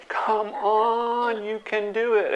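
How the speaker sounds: friendly pep from the slope above; encouraging friends. Retold or imitated. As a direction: cheer a nervous skier on. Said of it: "Now your friends go".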